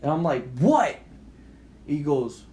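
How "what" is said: high, loud shout through a door; loud retold "what"; shouted question. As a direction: call out a startled challenge. Shouting through closed front door.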